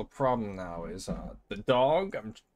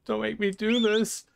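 pleading; 11 semitones higher, slower than usual. Acted. Pleading with dog in game.

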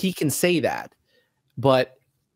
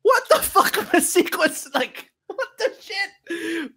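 high, laughing, near tears; laughing disbelief; 14 semitones higher than usual. Real, not acted. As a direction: squeal it through laughter and emotion. Gushing over the birth scene.

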